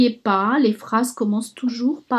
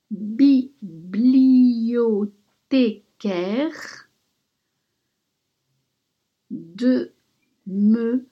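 dictating. Shown as a demonstration. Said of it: "Maintenant, je vais vous la dicter".

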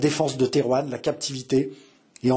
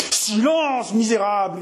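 furious yell; 8 semitones higher, slower than usual. A narrator's reading. François silencing his brother, yelling in fury. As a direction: shout him down with all your force. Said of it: "hurla François de toutes ses forces".

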